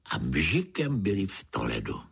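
ordinary delivery, nothing marked

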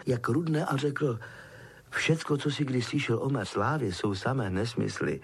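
usual speaking voice

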